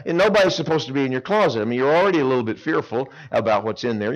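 normal talking voice

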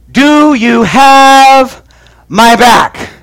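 drawn-out pleading question; slow plea. Retold or imitated. Asking cousin Clay for backup, slow drawn-out plea. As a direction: stretch it out, begging for backup.

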